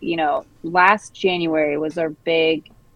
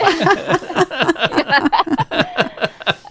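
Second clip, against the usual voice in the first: laughing; 11 semitones higher, 5 dB louder than usual. Real, not acted. Laughs about husband cooking.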